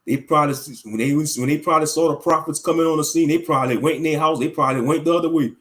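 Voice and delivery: normal talking voice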